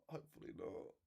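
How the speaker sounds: muttered to himself. Real, not acted. Streamer worries the live gets banned, muttered repeat. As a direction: trail off into a private mutter.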